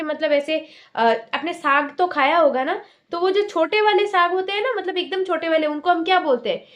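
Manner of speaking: ordinary talk, nothing marked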